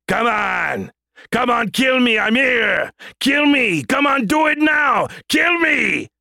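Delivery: booming Austrian action-hero shout; Austrian accent. Acted. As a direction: yell taunts in a thick Austrian accent. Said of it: "adding a suitable Austrian accent".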